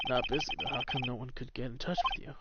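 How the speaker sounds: ordinary delivery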